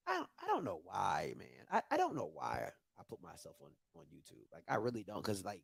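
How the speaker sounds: defeated mumble; muttering. Real, not acted. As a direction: mumble it half to yourself. Deflated after the play.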